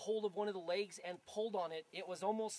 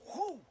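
much higher imitated whoosh; 11 semitones higher than usual. Describing the tent leg springing loose, a high whoosh sound. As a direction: mimic the leg snapping up like a spring.

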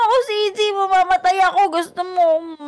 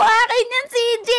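higher tearful shout; crying shout; 5 semitones higher than usual. Acted. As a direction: cry out to keep the sweets. Friend taking his sweets. (umiiyak na sigaw)

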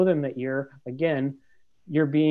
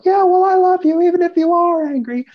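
yelled much higher and louder; angry yell up the stairs; 11 dB louder than usual. Shown as a demonstration. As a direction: shout it back up the stairs in a fury. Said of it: "you can't yell this up the stairs".

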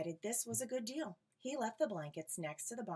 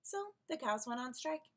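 usual speaking voice

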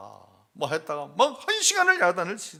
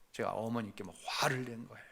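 hushed confession; 5 semitones lower, 10 dB quieter than usual. Real, not acted. Confessing he yelled at mother.

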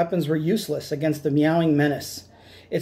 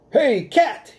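higher, louder call to the cat; shouting; 8 semitones higher, 4 dB louder than usual. Acted. Captain calls out to Cat Kong, shouting. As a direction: shout up to get the giant cat's attention. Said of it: "he shouted".

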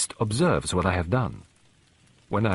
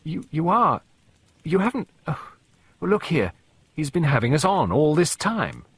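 put-on higher, sputtering young man catching on; flustered young man; 6 semitones higher than usual. Young man realising Poirot fooled them, flustered and higher. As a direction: stammer it out, higher, as the penny drops.

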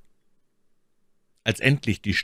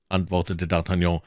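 ordinary delivery, nothing marked

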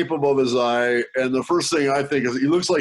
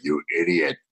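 much lower flat put-down; deadpan scolding; 8 semitones lower than usual. Retold or imitated. Wife on the phone, flatly scolding husband. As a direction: drop it dry and unimpressed. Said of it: "and she's like".

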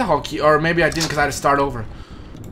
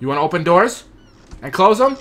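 usual speaking voice

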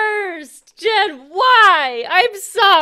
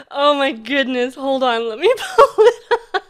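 ordinary talk

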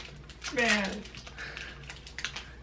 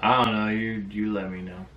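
usual speaking voice

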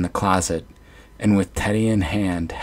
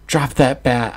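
higher, louder command; hollered; 6 semitones higher, 4 dB louder than usual. Acted. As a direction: holler it at her. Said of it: "I hollered at her".

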